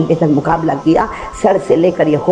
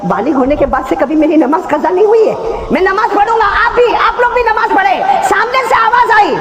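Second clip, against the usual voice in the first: preaching at full force; 11 semitones higher than usual. Acted. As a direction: cry it out to the crowd.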